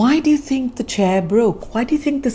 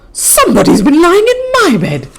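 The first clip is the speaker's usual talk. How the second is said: higher, louder and fast; middle-sized bear voice; 9 semitones higher, 7 dB louder, faster than usual. Acted. Middle-sized bear crying about her bed, higher and louder. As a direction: cry it out, mama bear. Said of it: "cried in a middle-sized voice".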